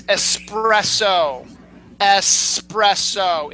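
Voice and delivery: espresso drawn out slowly, "esss-PRESS-oh"; correct pronunciation. Shown as a demonstration. Host teaching an easy coffee word, slow correct pronunciation. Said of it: "It's not espresso".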